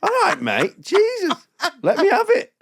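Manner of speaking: very high, hammed-up indignation; exasperated protest. Comedian told he's only apparently on telly, mock exasperation.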